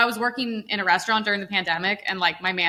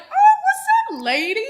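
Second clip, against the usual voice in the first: high, loud, stretched-out mock hello; put-on code-switched greeting; 6 dB louder, slower than usual. Imagining greeting restaurant customers in another voice, exaggerated high code-switch. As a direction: overdo the greeting, starting way up high. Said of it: "imagine I talk like this".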